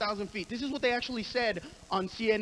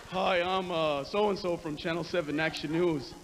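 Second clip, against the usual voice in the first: newscaster impression; 8 semitones lower than usual. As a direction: play a deadpan local news reporter. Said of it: "interrupted our show with this fucking brilliant analysis".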